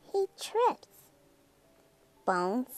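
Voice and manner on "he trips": squeak. Acted. Skeleton trips, voice jumps.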